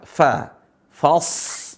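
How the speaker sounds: "Fa. Fas." with light open a, then long hissed s; thin fa. Shown as a demonstration. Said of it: "fa-nya tetap tipis".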